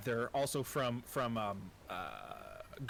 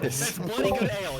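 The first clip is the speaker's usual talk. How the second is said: put-on louder, British-accented toast to the ale; 5 semitones higher, 10 dB louder than usual. The dwarf toasts the ale after the drink, loud British accent. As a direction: raise the voice and praise the ale in British. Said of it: "I default into the British".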